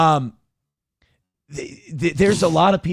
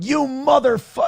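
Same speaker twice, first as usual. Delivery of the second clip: angry caller; 9 semitones higher than usual. Retold or imitated. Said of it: "people going".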